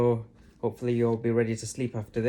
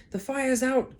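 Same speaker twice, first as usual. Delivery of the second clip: George announcing proudly; 11 semitones higher, 5 dB louder than usual. A narrator's reading. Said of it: "George announced proudly".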